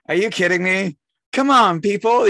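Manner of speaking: hammed-up disbelief; mock exasperation. To host family without a blender, mock exasperation.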